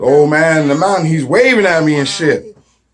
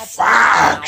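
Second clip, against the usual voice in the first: shouted curse; 7 semitones higher than usual. Retold or imitated. Swearing at the flight news.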